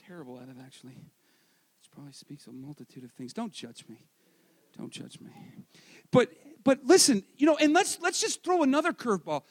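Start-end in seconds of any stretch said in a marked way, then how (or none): 0.1-5.3 very soft, low confession; sheepish aside. Real, not acted. Husband admitting he's not good at it, sheepish aside. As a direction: drop right down and mumble it to yourself.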